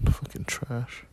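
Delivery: normal talking voice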